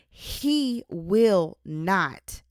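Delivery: drawn-out, weighty promise; slow emphatic stress. Acted. Reading God's promise to the listener, slow and emphatic.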